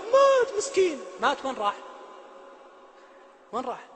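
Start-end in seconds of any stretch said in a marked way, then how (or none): 0.0-1.0 high, drawn-out mock lament; mock pity. Preacher mimics people lamenting a man killed, mock pity. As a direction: wail it in exaggerated pity.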